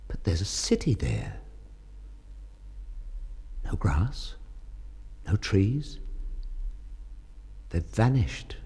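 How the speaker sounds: poem reading. Acted. Husband reading his wife's poem, softly and lovingly. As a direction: read it gently, as a memory.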